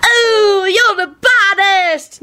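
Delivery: high-pitched gleeful character voice; delighted. A narrator's reading. Onlooker reacts to the punch, delighted. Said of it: "Nicholas told Kenny delightedly".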